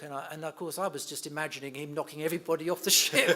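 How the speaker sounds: can barely get it out for laughing. Real, not acted. Son recalling the man overboard drill, laughing loudly. Laughing while telling cruise story.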